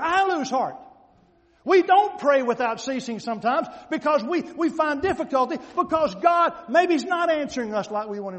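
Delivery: loud, emphatic pulpit delivery; full-force preaching. Real, not acted. Preacher telling congregation about the unjust judge, loud and emphatic. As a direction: drive the point home loudly.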